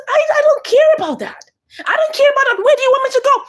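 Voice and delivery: distressed retold protest; upset high protest. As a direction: protest at the top of the voice range. She protests being told to leave.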